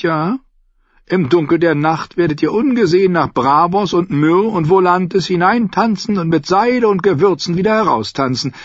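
a sly, friendly trader agreeing; Lyseni captain. Acted.

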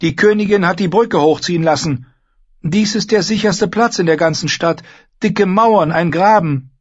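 high, loud address to a crowd; calling out. A narrator's reading. Girl tells the guests the castle is safe, calling out. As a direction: reassure the hall at full voice. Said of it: "rief sie".

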